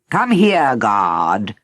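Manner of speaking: firm, commanding summons; stern. Acted. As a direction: call him over sternly.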